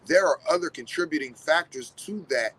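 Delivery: ordinary talk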